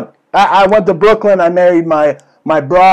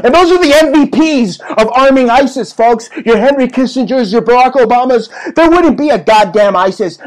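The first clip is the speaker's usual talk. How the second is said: high-pitched rant; 7 semitones higher than usual. Acted. Conspiracy rant at full pitch.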